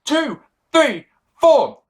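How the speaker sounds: counting out loud and high like a ref; mimicking referee count. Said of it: "The referee goes".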